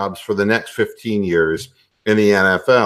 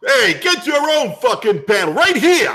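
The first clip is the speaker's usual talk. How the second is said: put-on higher-pitched, indignant New York accent; New York guy; 11 semitones higher, 3 dB louder than usual. Host defending his panel, indignant New York accent. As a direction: snap back as an offended New York guy.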